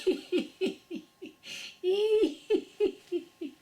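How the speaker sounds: loud, high ringing laugh; high burst of laughter. Shown as a demonstration. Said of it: "let a little sound out".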